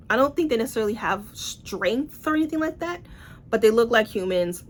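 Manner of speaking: ordinary delivery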